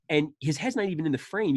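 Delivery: ordinary delivery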